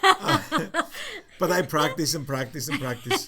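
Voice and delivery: laughing through the words; real amused laughter while talking. Real, not acted. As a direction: laughs at own stubborn accent. Laughing at failing to lose accent.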